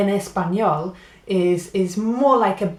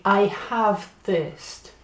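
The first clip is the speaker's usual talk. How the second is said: slow, deliberate teacher's phrasing; slow classroom model phrase; slow and clear; much slower than usual. Shown as a demonstration. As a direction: spell it out slowly for learners. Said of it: "You say".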